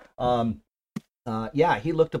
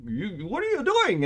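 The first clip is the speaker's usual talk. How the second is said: put-on high, startled French-accented question; mock French accent, pitched high; 10 semitones higher than usual. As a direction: imitate a Frenchman calling out in surprise. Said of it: "because of the accent".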